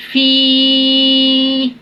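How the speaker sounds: فی with a long held ee; فی drawn out on a steady note; lengthened madd. Shown as a demonstration. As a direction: stretch فی out. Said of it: "مد کو ہم کھینچیں گے".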